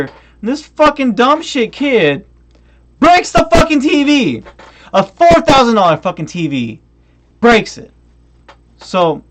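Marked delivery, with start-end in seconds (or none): 0.0-7.9 real anger, higher and louder; ranting at viewers about the broken TV, angry. Real, not acted. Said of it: "I'm pissed".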